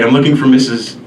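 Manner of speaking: ordinary talk, nothing marked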